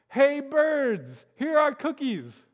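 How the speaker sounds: high, loud call to the birds; shouted. Acted. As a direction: call out across the yard. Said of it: "he shouted in a loud voice".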